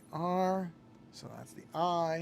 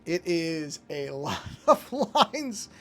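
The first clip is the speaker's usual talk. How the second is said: chuckling through the words; laughing; 3 semitones higher, 8 dB louder, much slower than usual. Real, not acted. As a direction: laugh while you say it. Marvels at his line work.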